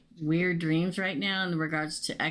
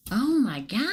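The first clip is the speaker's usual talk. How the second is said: high, stretched-out exclamation; astonished reaction; 8 semitones higher, 4 dB louder than usual. Real, not acted. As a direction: react with real astonishment at what turns up. Reacting to a card just drawn.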